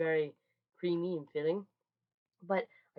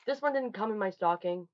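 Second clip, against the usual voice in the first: ordinary delivery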